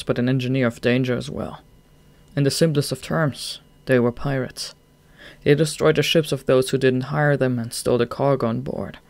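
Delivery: ordinary delivery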